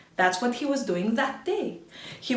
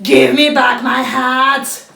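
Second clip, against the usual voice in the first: louder, slow and deliberate demand; slow angry shout; 13 dB louder, slower than usual. Acted. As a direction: spell out each word in fury. Man demands hats slowly.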